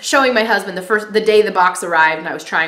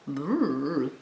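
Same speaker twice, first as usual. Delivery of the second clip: soft low rolling "brrr"; imitated wiggle noise; 5 semitones lower, 10 dB quieter than usual. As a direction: quietly mimic a rippling movement with a noise. Imitates baby moving in belly.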